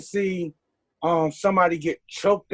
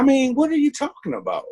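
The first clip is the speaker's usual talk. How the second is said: exasperated outburst; 8 semitones higher, 4 dB louder than usual. Real, not acted. Venting to the hosts about police double standards, exasperated and louder. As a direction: voice jumps higher and louder in disbelief.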